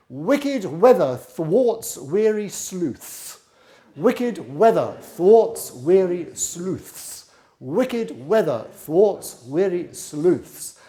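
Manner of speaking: slow, crisp consonants on every word; slow tongue twister. Shown as a demonstration. Flute teacher demonstrating a twister, slow and crisp. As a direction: chew through each word deliberately. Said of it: "This is a good one".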